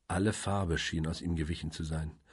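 usual speaking voice

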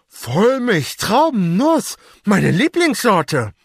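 high, slow, talking through stuffed cheeks; mouth full of chocolate. A narrator's reading. Federico with the whole bar in his mouth, talking through a mouthful. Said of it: "Backen so dick wie die eines Hamsters".

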